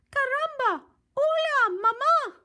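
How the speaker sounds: put-on very high loud squeaky baby voice; baby chick. Baby chick greets Jack, high squeaky squeal.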